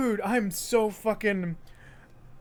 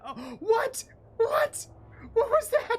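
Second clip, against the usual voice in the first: very high squeaky surprise; high-pitched baffled "what?"; startled what; slower than usual. Real, not acted. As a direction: shriek in disbelief. Sudden surprise in game.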